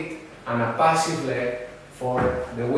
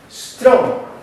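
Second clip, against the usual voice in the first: Strong called out much higher and louder; strong beat; 9 semitones higher, 7 dB louder than usual. Shown as a demonstration. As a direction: punch Strong hard like a dancer's downbeat. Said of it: "but weak with accent".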